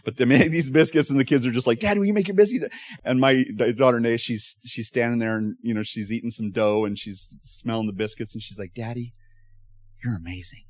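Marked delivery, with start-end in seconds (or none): 1.8-2.7 put-on kids begging. Quoting the kids. 8.7-10.6 put-on soft child voice. Quoting his three-year-old.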